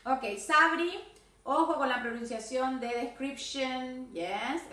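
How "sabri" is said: usual speaking voice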